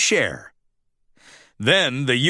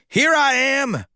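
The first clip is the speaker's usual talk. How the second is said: high, bold announcing voice; grand announcement; 11 semitones higher than usual. A narrator's reading. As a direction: burst in and announce it. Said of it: "he announced".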